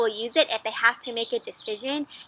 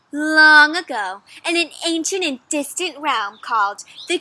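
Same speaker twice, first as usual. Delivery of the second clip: story opening; 3 semitones higher, 5 dB louder than usual. A narrator's reading. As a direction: draw out the legend's first words.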